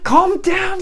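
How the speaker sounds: urgent calming. Acted. Acts rescuer grappling a struggler.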